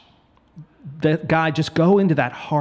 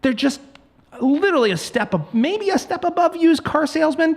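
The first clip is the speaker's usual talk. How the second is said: heated rant; 9 semitones higher than usual. Real, not acted. Ranting about realtors.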